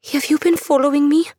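put-on a scared girl demanding answers; wary young woman. Andula voiced by narrator.

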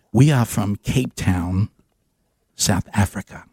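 slow, low South African accent, lightly put on. South African visitor answers Gary, in a slow, low South African accent.

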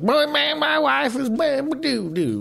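high, slow hack stand-up comic voice; mock a lazy comic whining about his wife. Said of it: "do you want the guy like".

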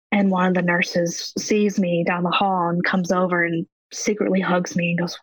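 normal talking voice

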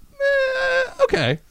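very high, crying-like squeak; strained whiny okay. Retold or imitated. His awkward reply retold.

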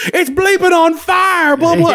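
high, drawn-out mock chef yell; Gordon Ramsay. Chef yelling at his monster helper, mock furious. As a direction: rant like an exasperated celebrity chef. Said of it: "Gordon Ramsay is yelling at Sweetums".

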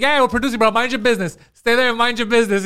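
retelling friend's dismissal of parking guy; cocky brush-off. Retold or imitated. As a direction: dismiss him with swagger. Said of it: "right away, he's like".

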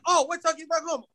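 high-pitched mock English accent. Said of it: "you can hear his accent come out".

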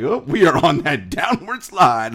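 high, loud, laughing mock weariness; sleepy wind-down laughing. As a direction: laugh through the downward slide. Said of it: "your boy over here is like".

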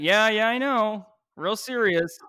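loud, drawn-out skeptical agreement; mock dismissal. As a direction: imitate a friend humoring you. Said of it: "And you're like".

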